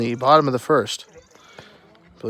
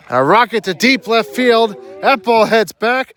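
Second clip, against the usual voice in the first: home run call; 7 semitones higher than usual. Real, not acted. Home run call, excited play-by-play.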